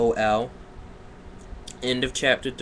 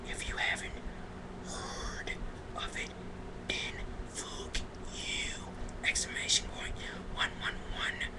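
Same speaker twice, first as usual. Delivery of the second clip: hushed, breath-only whisper; 12 dB quieter than usual. Pretend. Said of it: "She want me to whisper it?".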